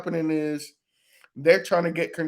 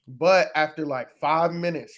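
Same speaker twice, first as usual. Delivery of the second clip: normal talking voice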